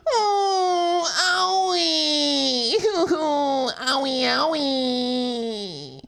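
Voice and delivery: high, drawn-out crybaby wailing; hammed-up crying over a boo-boo; mock sobbing wail. Streamer imagining a torn ligament, mock sobbing wail. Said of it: "I would tear the ligament and then go".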